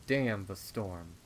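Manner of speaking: plain reading voice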